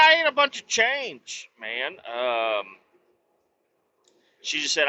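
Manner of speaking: ordinary delivery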